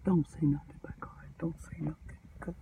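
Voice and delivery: urgent whisper; whispered warning. Retold or imitated. Runaway slave whispering a warning to Barbara. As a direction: breathe the warning so nobody hears. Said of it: "it's like the runaway slave said".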